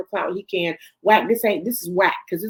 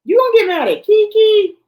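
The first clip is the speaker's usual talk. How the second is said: much higher, loud, slower disbelieving yell; outraged shout; 13 semitones higher, 9 dB louder than usual. Real, not acted. Host yells at Chrisean for turning on Keke, outraged shout. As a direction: blow up at her in disbelief.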